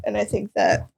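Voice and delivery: tearful, voice breaking, pitch up, words half-breathed; real tears, she pushes the words out anyway; crying while talking. Real, not acted. Guest tearing up on a friend's podcast, crying through her words. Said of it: "First time crying on your podcast".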